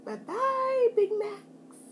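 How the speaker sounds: high, sing-song bye-bye to the burgers; mocking farewell. Real, not acted. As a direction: wave the Big Macs off in a teasing high voice. Mocking farewell to Big Macs.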